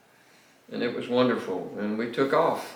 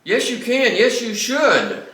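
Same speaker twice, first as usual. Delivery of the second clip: higher, louder, slowing on Yes, you should; telling pastors they must take rest, insistent; emphatic insistence; 10 semitones higher, 5 dB louder, slower than usual. Real, not acted. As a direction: press the point home firmly. Emphatic repeated answer.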